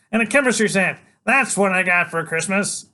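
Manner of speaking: put-on high, loud cocky little boy; a tiny hero bluffing a killer; bold kid voice. Freak bluffing Killer Kane, high and loud. Child voice.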